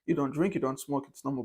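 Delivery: soft, low, relaxed reassurance; lightly voiced easygoing friends saying it's fine; calm reassurance. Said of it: "They'll be like".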